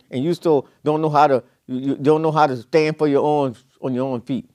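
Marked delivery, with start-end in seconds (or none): none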